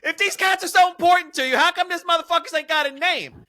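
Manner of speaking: high, loud comic tirade; outraged disbelief at full volume; exasperated rant. Real, not acted. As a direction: build into an incredulous, high-pitched rant. Climactic rant about nameless cats.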